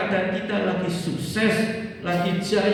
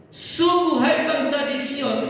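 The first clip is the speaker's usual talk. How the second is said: high ringing call to Zion; proclaiming; 7 semitones higher, slower than usual. Acted. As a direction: lift the words like a herald. Preacher repeats verse opening. (seruan lantang)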